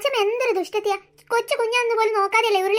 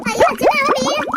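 loud, high panicked warning; alarmed cry; 5 semitones higher, 5 dB louder than usual. Acted. Child warning grandmother the little one will fall, alarmed shout. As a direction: cry out to stop her.

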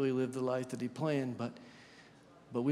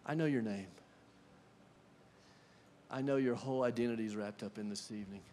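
tender Jesus; 5 semitones lower, 5 dB quieter, faster than usual. Acted. Jesus speaks tenderly to Martha.

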